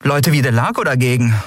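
captain griping to crew. A narrator's reading. Captain complains to her crew.

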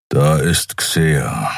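low, slow grumble; Kero grumbling. A narrator's reading. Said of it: "brummte Kero schließlich". (Kero brummt langsam und tief)

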